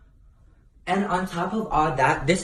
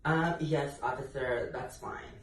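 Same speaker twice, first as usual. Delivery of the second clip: meek quiet answer; 13 dB quieter than usual. Retold or imitated. Meek reply to cop, retold.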